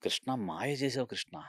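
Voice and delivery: slow, soft, marvelling voice; awed praise. Retold or imitated. Director amazed at the overnight set, slow awed murmur. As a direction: let the wonder slow you down.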